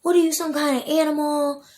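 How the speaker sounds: loud, high mock of a furious yell; angry yelling quote. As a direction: throw the angry question at a child. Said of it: "She's yelling and screaming in my face".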